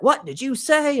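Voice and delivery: high, sharp mock principal demand; stern principal. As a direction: catch the kid out like a shocked principal. Principal scolding.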